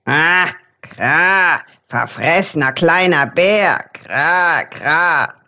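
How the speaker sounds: the raven mutters to himself about the bear, a croaky murmur; muttering raven. A narrator's reading.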